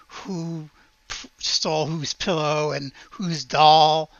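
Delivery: ordinary delivery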